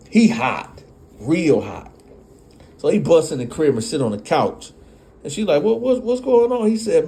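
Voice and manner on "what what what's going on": worried question. Retold or imitated. Girlfriend asks.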